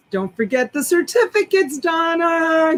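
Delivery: high called-out name; calling out. Real, not acted. Host calling out to Donna about the certificates. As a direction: call across the room.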